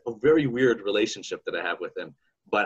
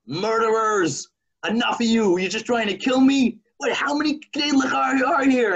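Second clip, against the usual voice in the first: high, loud, outraged yell at the food; furious shout; 13 semitones higher, 4 dB louder than usual. Acted. Stuffed husband shouting at the knedlach, furious high yell. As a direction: scream it like the dumplings are trying to kill you.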